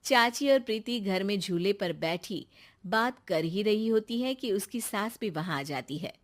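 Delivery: plain reading voice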